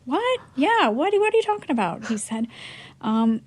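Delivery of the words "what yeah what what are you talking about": high, rising confused questions; bewildered. Retold or imitated. On the phone with the player, high bewildered surprise. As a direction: sound thrown off and curious.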